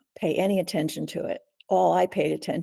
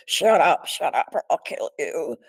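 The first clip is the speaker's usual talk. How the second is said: louder, harsher retelling of the intruder; harsh threat; 4 dB louder than usual. Retold or imitated. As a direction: bark it out as the attacker. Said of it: "And it was really like".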